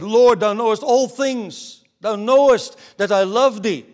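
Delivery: loud, high, impassioned declaration; Peter. Acted. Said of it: "Peter said".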